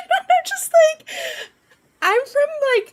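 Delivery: very high, squeaky with laughter; laughing. Real, not acted. Streamer retelling her reply to the lady, laughing. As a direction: try to talk while cracking up. Breaking into laughter telling story.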